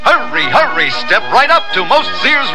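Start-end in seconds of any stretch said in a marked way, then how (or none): none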